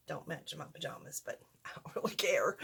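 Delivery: amused whisper with a laugh; a giggly whispered aside about the pajamas. Real, not acted.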